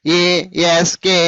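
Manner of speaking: ASK slow, each letter apart; divided letters. Shown as a demonstration. Teacher showing pupils what not to say, ASK slowly divided. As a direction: break ASK up with gaps between letters. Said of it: "అనరాదు".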